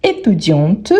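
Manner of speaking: "Étudiante" with a clearly sounded final t; final T pronounced. Shown as a demonstration. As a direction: release the last t of "Étudiante". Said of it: "on prononce bien le T".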